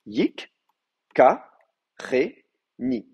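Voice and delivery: "yik, ka, ré, ni" one syllable at a time; split into syllables. Shown as a demonstration. Teacher showing open and closed syllables, "yik, ka, ré, ni" spaced apart. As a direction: pause between each syllable of "yik, ka, ré, ni". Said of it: "j'ai donc une syllabe ré".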